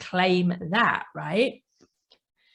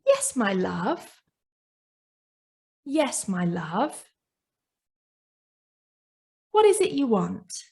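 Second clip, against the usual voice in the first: tender mother. Retold or imitated. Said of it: "you as the mother ... say".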